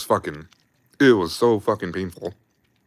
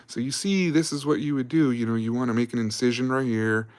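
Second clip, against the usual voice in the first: mock instructor voice, pitched higher; lecturing doctor; 5 semitones higher than usual. Doctor showing watching combat medics where to cut, higher-pitched instructor voice. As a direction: imitate a doctor walking trainees through it.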